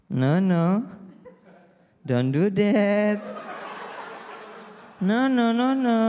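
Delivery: high, gentle English-speaking rich dad; posh mall dad. Retold or imitated. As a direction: coo at the child like a rich Jakarta father. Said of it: "Orang kaya".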